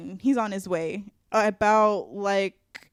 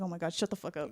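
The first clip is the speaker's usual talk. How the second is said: muttered brush-off; 4 semitones lower, 12 dB quieter, faster than usual. Retold or imitated. Brushing off coworker.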